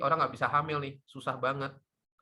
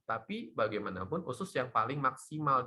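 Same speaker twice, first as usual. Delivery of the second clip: ordinary talk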